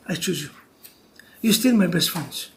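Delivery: low, hushed declaration; tender wife. Acted. Wife standing by husband through hardship, tender. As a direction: drop low and soft, reassuring him. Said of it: "And the wife said".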